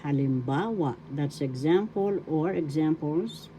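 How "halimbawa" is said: halimbawa, stressing a long BA; teacher's model of halimbawa, accent on BA; stress on third syllable. Shown as a demonstration. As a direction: stretch the ba in halimbawa. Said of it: "The accent is in the third syllable".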